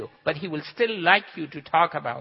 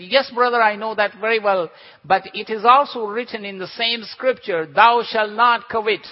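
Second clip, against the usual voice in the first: smug retort; 4 semitones higher, 6 dB louder than usual. Retold or imitated. Retort in the train joke.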